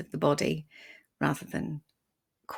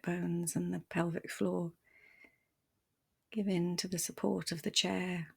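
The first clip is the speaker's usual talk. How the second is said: soft, unhurried meditation voice with long pauses; guided meditation; 4 dB quieter than usual. Acted. Meditation teacher guiding listeners to settle the body, softly and calmly. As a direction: ease them gently into stillness, leaving space between phrases.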